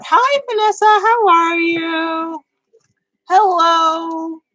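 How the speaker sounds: high, drawn-out singsong hello; excited greeting. Real, not acted. Greeting someone who has just arrived.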